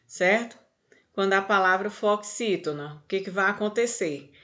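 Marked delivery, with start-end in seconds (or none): none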